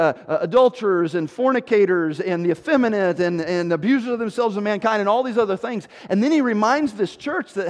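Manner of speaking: normal talking voice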